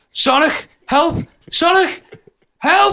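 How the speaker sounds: yelling for help, hanging off the wall in a panic; retold panicked cry. Boy clinging to the bouncy castle calls his mate in panic, screaming. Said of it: "I was going".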